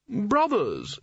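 high, drawn-out call to his brothers; pompous wizard. A narrator's reading. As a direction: open the speech grandly. Spelter addresses the wizards.

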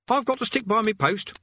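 a simple guard clinging to duty; dutiful guard. Acted.

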